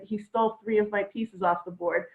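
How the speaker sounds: normal talking voice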